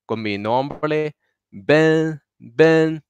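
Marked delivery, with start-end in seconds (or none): 1.5-3.0 "Ben" held high and even; high level tone. Shown as a demonstration. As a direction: say "Ben" on one high, unbending pitch. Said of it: "es muy alto".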